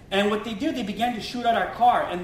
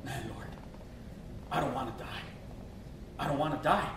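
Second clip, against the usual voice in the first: much lower, very soft plea; desperate prayer; 8 semitones lower, 11 dB quieter than usual. Retold or imitated. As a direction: whisper a scared prayer to God. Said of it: "I'm sitting there, I'm saying".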